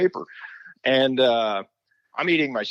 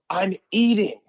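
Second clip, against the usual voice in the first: indignant; 9 semitones higher, 3 dB louder, slower than usual. Retold or imitated. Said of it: "I go".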